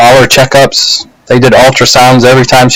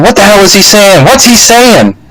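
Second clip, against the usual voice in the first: much higher, frantic imitation; mock panic; 8 semitones higher than usual. Wife in labour can't understand the doctor, acted-out panic. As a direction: imitate her pitched-up, panicked questions. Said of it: "she's kinda panicking".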